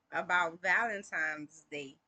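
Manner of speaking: ordinary talk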